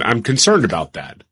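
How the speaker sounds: ordinary talk, nothing marked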